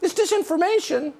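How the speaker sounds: high, lilting mockery; mock sing-song parody; sing-songy mock voice. Shown as a demonstration. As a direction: slip into the pundit's sing-song. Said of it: "in a sing-songy way".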